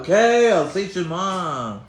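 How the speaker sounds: high, loud groaned okay, then dragged out slowly; mock teen giving in under protest; annoyed teenager. Teenager grudgingly agreeing to pray to God, annoyed and drawn out. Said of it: "like, annoyed teenagers with God".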